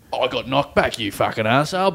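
macho angry bloke. Retold or imitated. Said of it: "that masculine thing of".

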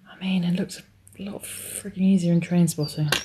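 breathless whispered aside. Real, not acted. Woman injecting hormones, muttering to herself, real whisper. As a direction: whisper it mid-injection, tense.

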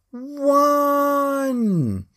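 high ringing countdown cry; crowd shout. Retold or imitated. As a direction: shout the final number with the crowd. Whole place shouted as one.

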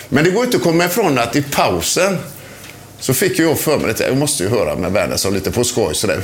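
ordinary delivery

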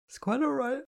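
very high words stretched by a yawn. A narrator's reading. Astrid excuses Kitty for waking her, yawning. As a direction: talk right through a yawn. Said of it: "Astrid yawned again".

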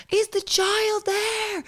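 high, slow worried question; imitating a concerned neighbour; anxious neighbour. Neighbour asking about the child, high and slow.

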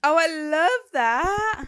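high, drawn-out delight. Real, not acted. Guest hearing the host did her survey, delighted.

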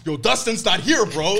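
high, loud mock protest; defiant drunk friend. Drunk friend answers his teasing buddies, mock defiant. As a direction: imitate a friend loudly objecting.

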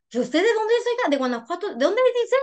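imitando a una preguntona, tono muy agudo; high-pitched mock questioning, rising sharply on key questions; curiosa preguntona. Otra hermana la interroga curiosa. (imitando preguntas curiosas con voz aguda)

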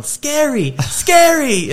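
very high, imitated kid's cry; mock toddler yelling. Dad imitating his son scared by the dryer, mock yelling. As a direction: yell it like a scared little kid, rising in volume. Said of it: "he started yelling".

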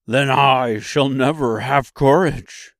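loud, slow lion voice; big beast's drawn-out lament. A narrator's reading. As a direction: declare it loudly as the lion. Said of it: "declared the lion".

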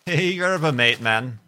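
high, loud laid-back surfer greeting; hammed-up beach bro "hey man"; surfer dude. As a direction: hail a buddy like an Aussie surfer. Surfer-dude bit about mate.

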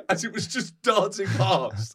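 ordinary talk, nothing marked

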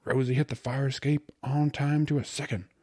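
high, loud, elated bragging; grinning, exultant slangy bridegroom; joyful triumph. A narrator's reading. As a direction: gush the good news like a winner. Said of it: "with a victor's smile and flushed with joy".

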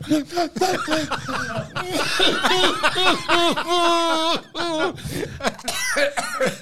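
high wailing sob-laugh; choked cry imitation. Shown as a demonstration. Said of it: "And I went".

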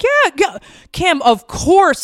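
high, loud, eager reassurance; eager agreement. Retold or imitated. Trainer agreeing to a crying mother's plea, eager and loud.

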